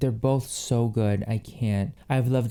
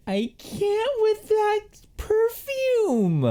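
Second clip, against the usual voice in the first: a squeal, much higher and drawn out; overjoyed squeal; slower than usual. Real, not acted. Overwhelmed by the perfume, she squeals with delight. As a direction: squeal it like you can't take it.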